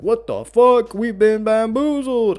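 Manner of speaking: very high, loud, stretched-out yell; shocked outcry. Acted. Baffled enemies after he teleports back in, shocked outcry. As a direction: holler it like you've been completely tricked. Acted group outcry at being tricked.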